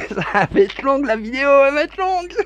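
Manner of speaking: high laughing words, fast; after laughing hard. Real, not acted. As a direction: say it while still laughing. Still laughing after breakdown.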